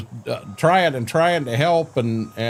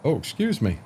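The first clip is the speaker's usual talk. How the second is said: hiccuping between words; talking through the hiccups; 4 semitones higher than usual. Real, not acted. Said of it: "God, I got the hiccups".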